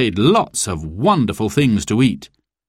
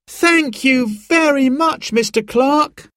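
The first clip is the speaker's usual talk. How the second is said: put-on high, slow, rising thank you from the children; excited children's thanks. A narrator's reading. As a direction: thank him eagerly like delighted kids. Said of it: "cried Simon and Sarah".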